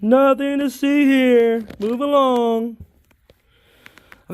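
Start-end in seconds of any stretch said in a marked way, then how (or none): 0.0-2.8 high, slow, dopey cop voice; Officer Barbrady. Retold or imitated. Quoting South Park's cop to viewers, dopey slow high voice. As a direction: drawl it like a clueless cartoon cop. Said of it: "Officer Bar Brady".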